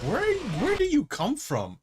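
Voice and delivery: surprised. Real, not acted. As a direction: caught off guard by a monster. Monster suddenly appears.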